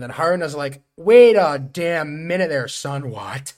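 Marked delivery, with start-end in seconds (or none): 1.0-3.1 loud, slow, bossy scolding; half-playful loud put-down; mock stern shout. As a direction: bark it like a cocky boss. Haruna acted scolding.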